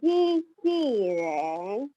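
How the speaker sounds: "Ji, ji ren" very slow and even; teacher modelling "Ji, ji ren" slowly; slow tone model. Shown as a demonstration. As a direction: draw out "Ji, ji ren" for the class to copy.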